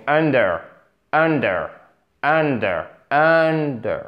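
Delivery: under with UN- drawn out and falling on -der; slow demo of UN-der, stressed up front; stress on first syllable. Shown as a demonstration. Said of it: "Accentuation sur la première syllabe".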